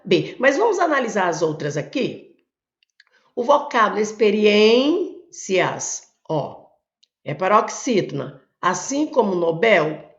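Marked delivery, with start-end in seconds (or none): 4.0-6.1 "experiências" with a long, loud ÊN, then cias apart; teacher marking the strong syllable of "experiências"; stressed syllable held. Shown as a demonstration. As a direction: stretch and lift ÊN in "experiências", pause before the ending. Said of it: "sílaba forte".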